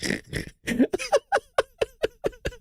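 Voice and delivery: high, wheezy giggle; helpless stuttering high laugh; real laughter. Real, not acted. Laughs at chat comments.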